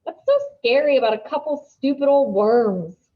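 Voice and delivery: loud sneering taunt. A narrator's reading. Dylan sneers at Lucy over the leeches. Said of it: "sneers Dylan".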